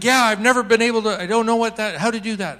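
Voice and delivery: high, loud tumbling reply; manic blurting. Retold or imitated. Woman admitting she can't pray, manic blurting. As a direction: imitate someone answering before she can think. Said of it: "she's answering faster than she can think".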